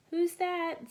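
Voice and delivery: high, loud call from downstairs; Mommy calling. A narrator's reading. Mother calling out to see who's there. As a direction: call out like a surprised mom. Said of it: "says Mommy".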